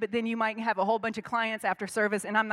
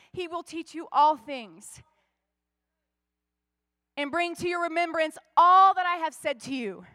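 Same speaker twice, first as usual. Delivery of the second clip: higher and louder, pleading as Jesus to the disciples; full-force preaching; 5 semitones higher, 4 dB louder than usual. Acted. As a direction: press the promise home, rising.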